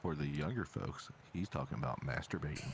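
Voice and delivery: smooth radio DJ announcement; a hint of a late-night DJ. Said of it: "like a fucking radio D J right in my ear hole".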